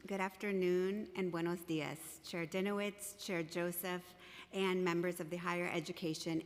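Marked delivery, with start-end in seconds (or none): none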